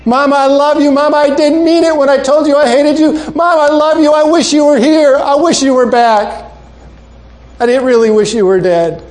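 high, loud, sobbing plea to her mother; crying wail. Acted. Girl weeping over her mother's casket, high sobbing plea. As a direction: cry it out as a broken-hearted girl. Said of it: "Patty is still saying those same words".

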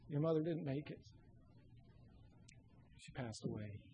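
low and soft, falling away; grave, hushed news. Acted. Principal telling girl her mother died, grave and hushed. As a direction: break it very quietly and gravely.

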